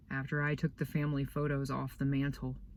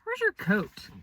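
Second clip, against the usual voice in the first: much higher, fussing teacher voice; concerned teacher; 3 dB louder than usual. Retold or imitated. Boy voicing Missus B asking about his coat, high fussy voice. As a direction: mimic a worried teacher.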